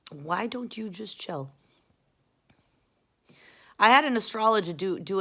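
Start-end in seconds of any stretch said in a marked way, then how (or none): 0.1-1.5 slow, softer, measured repeat; slow plea. Retold or imitated. She tells difficult people to relax, slow soft plea. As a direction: draw each word out quietly.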